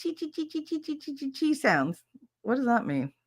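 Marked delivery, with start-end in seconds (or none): 0.2-2.0 high, soft rapid "chi, chi, chi"; chi chi sounds. Retold or imitated. Mocking how Beijing sounds, high rapid chi. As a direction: patter "chi" quickly and high. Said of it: "you say Beijing, and I think".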